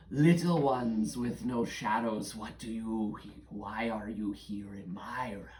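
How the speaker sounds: imperious, whispery creature voice; shadow creature. Acted. Shadow creature questioning little ones in his realm, imperious and whispery. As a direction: address the intruders slowly, trailing into a whisper.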